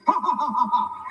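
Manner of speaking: loud hammed-up ha ha ha; loud put-on laughter; mock laugh. Mock laugh at Wilt Chamberlain.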